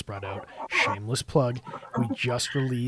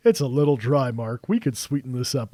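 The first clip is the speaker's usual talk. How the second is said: mock complaint; 2 semitones higher, 8 dB louder than usual. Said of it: "and I'm like".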